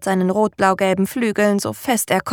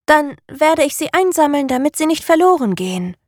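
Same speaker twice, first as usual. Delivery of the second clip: hohe, eifrige Affenstimme; kleiner Affe; 7 semitones higher than usual. A narrator's reading. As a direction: sag es eifrig wie ein kleiner Affe. Affe beschließt. (hohe eifrige Stimme)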